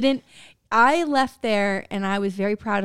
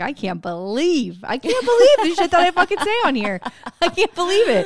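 mortified disbelief; 8 semitones higher, 4 dB louder than usual. Retold or imitated. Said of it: "I'm like".

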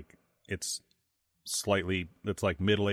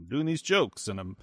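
higher-pitched bad comedian impression; terrible Dangerfield; 6 semitones higher than usual. Podcaster imitating a confused, angry comedian on set, bad impression. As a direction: do a poor, indignant impression of a confused comic. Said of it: "There's a terrible, terrible impression".

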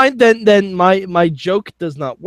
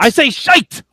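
higher, insistent mock-British accent; put-on British accent; 8 semitones higher, 3 dB louder than usual. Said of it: "in that accent now".

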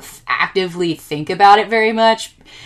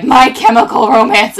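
excited; 6 semitones higher, 10 dB louder than usual. Retold or imitated. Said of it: "they were like".

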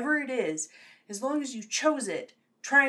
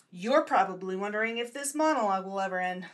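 ordinary delivery

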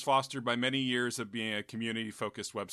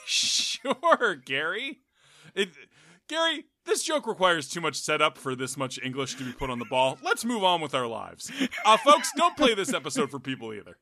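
Quagmire; 6 dB louder than usual. Acted. Co-host obliging Gary's request to tell the joke, Quagmire impression. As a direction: do a hammed-up cartoon voice, pushed up and loud. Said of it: "Can you do it in Quagmire's voice?".

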